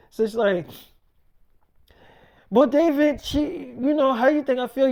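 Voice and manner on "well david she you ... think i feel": imitating defensive girlfriend. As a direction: mimic her indignant comeback. Girlfriend pushing back, defensive.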